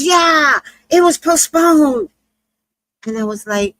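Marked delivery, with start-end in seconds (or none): 0.0-2.1 loud, high-pitched excited confirmation; hammed-up, high and loud; Flint worked up. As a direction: imitate an excitable guy yelling yes. Acting out Flint's crazy excitement.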